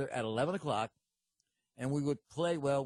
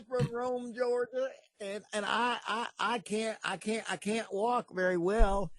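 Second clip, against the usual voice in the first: higher-pitched, halting mock supplicant; afflicted churchgoer; 6 semitones higher than usual. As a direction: stammer out the ailment like a pitiful pilgrim. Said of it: "he start healing people".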